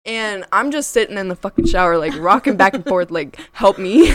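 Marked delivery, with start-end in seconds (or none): 3.5-4.1 loud, laughing cry for help; half-laughing help me; laughing plea. Retold or imitated. Rocking in the shower, scared.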